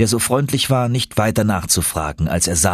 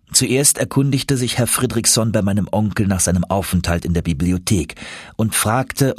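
plain reading voice

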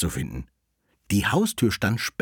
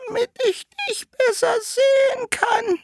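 wolf as grandmother; 5 dB louder than usual. Acted. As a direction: drag it out in a squeaky fake granny voice. Wolf posing as grandmother answers.

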